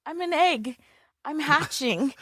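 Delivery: high, light imitation of a child; playful small-child announcement, imitated; little girl. Mom imitating her daughter hatching in the car seat, little-girl voice. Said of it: "She's like".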